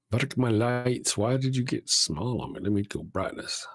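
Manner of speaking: normal talking voice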